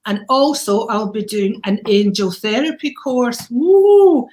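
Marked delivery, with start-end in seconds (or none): 3.5-4.3 high, loud celebratory woo; a joyful cheer, much higher and louder; excited whoop. Real, not acted. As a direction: whoop it up with delight. Cheering her angel therapy course.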